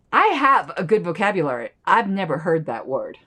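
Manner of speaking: ordinary talk, nothing marked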